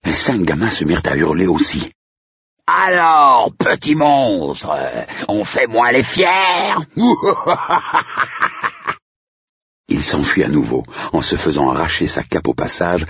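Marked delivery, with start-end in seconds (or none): 2.7-6.7 high, drawn-out villain shout; loud theatrical ghoul pouncing on prey; howling majordomo. A narrator's reading. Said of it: "bondit d'un fourré en hurlant". 6.8-8.9 high maniacal villain laugh; long staccato horror cackle, fading out; gloating high-pitched laughter; evil cackle. Acted. Majordomo cackles after scaring children.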